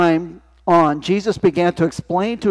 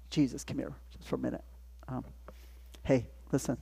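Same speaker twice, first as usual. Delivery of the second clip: much softer, quick, secretive; hushed aside; 3 semitones lower, 17 dB quieter, faster than usual. Acted. Peter pulling Jesus aside, speaking in a quick hush. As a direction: pull him aside in a hush.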